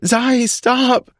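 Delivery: high female voice, pleading; girlfriend pleading. A narrator's reading. Alex begging Zai to stop, high female plea.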